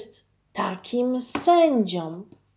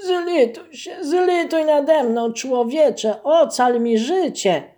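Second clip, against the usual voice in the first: high, pleading bear's cry for mercy; pitiful plea; 6 semitones higher, 5 dB louder than usual. Acted. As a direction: beg desperately for your life. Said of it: "mówi żałośnie to niedźwiedzisko". (żałosne błaganie niedźwiedzia)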